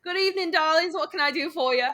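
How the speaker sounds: high-pitched British barmaid; female bartender. Acted. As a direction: greet the pub customers as a put-on barmaid. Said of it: "There's a female bartender there. You can play that one".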